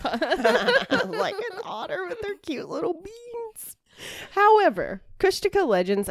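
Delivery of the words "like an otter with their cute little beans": very high, slow squeaky coo; high little baby-talk voice; cutesy cooing. Pretend. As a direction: gush over something adorable, drawn out. Cooing over cute otters.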